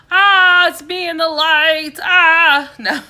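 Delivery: a high, loud, hammed-up startled yelp; mock fright. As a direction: pretend to be scared by your own light, then drop it. Fakes a fright, then I'm joking.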